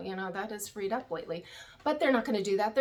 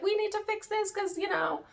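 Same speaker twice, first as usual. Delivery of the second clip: high-pitched mock pleading; Robin; 11 semitones higher than usual. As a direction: imitate an urgent plea, pitched way up. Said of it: "Robin's like".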